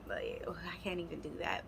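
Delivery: whispered hurt, very soft. Retold or imitated. Woman pleading with cheating boyfriend, whisper. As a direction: let it sink to a whisper.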